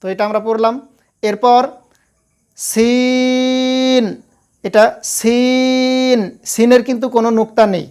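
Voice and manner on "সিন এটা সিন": সিন held long with a thin hiss; drawn-out thin seen. Shown as a demonstration. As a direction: stretch সিন lightly at the teeth. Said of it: "এটা পাতলা". (পাতলা করে টেনে সিন)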